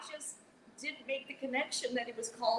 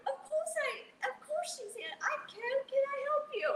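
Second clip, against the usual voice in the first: put-on British accent. Radio host answering [name], high mock British accent. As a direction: fake a posh British receptionist voice in a high pitch. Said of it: "mimic him back in a British accent".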